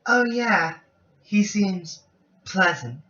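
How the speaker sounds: put-on high-pitched girlish remark, spaced out; anime girl voice. Heroine remarking on the professor, anime girl voice. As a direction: start high and girlish, phrase by phrase. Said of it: "just do the anime girl voice".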